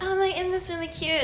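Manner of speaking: mock-sweet pregnant friend gushing. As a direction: coo over the baby stuff. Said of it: "it's always like".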